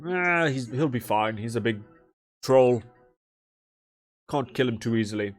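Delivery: lightly voiced jovial brush-off; jovial npc. Npc reassures about the drunk troll, jovial. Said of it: "he goes".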